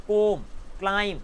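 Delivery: ordinary talk, nothing marked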